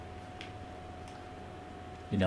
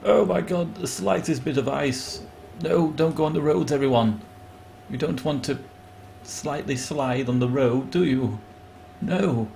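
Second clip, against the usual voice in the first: high, hammed-up alarm over ice; imitating overblown national hysteria; mock panic; 5 semitones higher than usual. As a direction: gasp and panic in mock horror. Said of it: "we all shit ourselves like".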